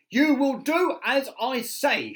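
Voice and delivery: very high, loud command; shouted. Acted. Miss Bossy shouting at her boots, very high and loud. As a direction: yell it like a furious bossy boss. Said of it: "shouted Miss Bossy".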